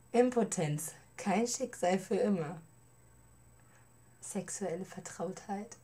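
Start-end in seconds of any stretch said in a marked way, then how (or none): none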